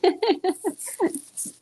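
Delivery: short, high burst of laughter; light laugh. Real, not acted. Laughs after own remark.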